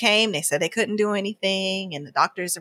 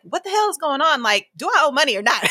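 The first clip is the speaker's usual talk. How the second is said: higher, fed-up demand breaking into a laugh; exasperated outburst; 7 semitones higher than usual. Retold or imitated. To the insurance company, exasperated and half laughing. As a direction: blurt it out, half laughing at the absurdity. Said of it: "I'm like".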